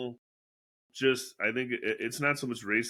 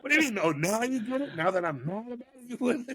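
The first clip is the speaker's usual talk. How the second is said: mock exasperated rant; 9 semitones higher than usual. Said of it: "You're like".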